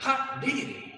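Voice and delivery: put-on high, old-time Southern exclaim; high put-on old-time twang; old-timey drawl. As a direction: exclaim like a 1930s outlaw. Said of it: "Clyde's immediately like".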